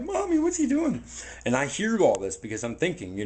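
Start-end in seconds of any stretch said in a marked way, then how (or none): 0.0-1.0 put-on high, childlike voice of a scared kid; little kid. Man retelling the kid who asked his mom. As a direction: squeak it like a small child asking mom. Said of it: "this little kid was like".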